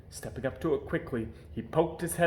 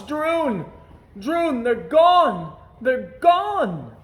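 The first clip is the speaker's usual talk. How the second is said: high, loud, slow wailing cry; mournful shouts; 11 semitones higher, 9 dB louder, much slower than usual. A narrator's reading. The king shouting for Droon over his missing stilts, wailing. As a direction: wail it down the castle halls in despair. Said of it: "the king's mournful shouts".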